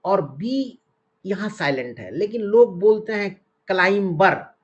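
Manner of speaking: ordinary talk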